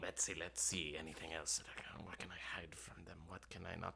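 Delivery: unvoiced whisper to himself; whispered aside. Imp whispering to himself what to hide, hushed. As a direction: scheme under your breath.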